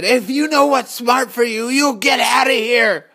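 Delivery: high, loud panicked shout; frantic warning. Acted. Fleeing man shouts at Courage, panicked. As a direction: yell the warning as you flee.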